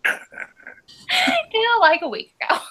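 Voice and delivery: repeating the words through real laughter; laughing at her friend's admission; laughing echo. Real, not acted. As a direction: giggle out the echo, high and breathless. Echoing friend's confession, laughing.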